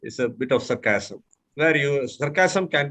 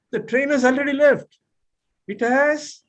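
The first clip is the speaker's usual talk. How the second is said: much higher, genuine dismay on "It has"; natural with feeling; 10 semitones higher than usual. Shown as a demonstration. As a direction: let the feeling come out naturally. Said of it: "natural at the same time bring out the feeling".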